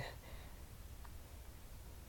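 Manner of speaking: short airy laugh; breathy laugh. Real, not acted. Laughs at own dramatics.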